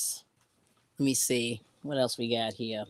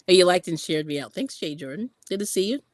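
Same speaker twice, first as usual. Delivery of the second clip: ordinary delivery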